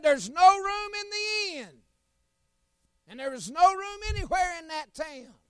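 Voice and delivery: high, loud, slow innkeeper's refusal; preacher shouting the innkeeper's no; innkeeper refusing. Retold or imitated. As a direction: call it out like a gruff innkeeper at the door. Innkeeper voiced in the story.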